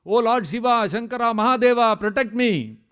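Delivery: high, pleading cry for help; calling out. Retold or imitated. Ravana begging Lord Shiva to save him, calling out. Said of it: "he started calling out".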